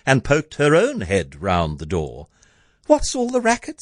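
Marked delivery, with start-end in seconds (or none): 2.9-3.8 high-pitched calling question; high, startled query; Cindy's cry. A narrator's reading. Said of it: "Cindy cried".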